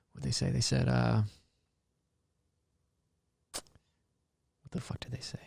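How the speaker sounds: a hushed whisper as he tries to remember; whispered to himself. Real, not acted. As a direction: whisper it under your breath, searching your memory. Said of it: "forgetting the comment, to himself".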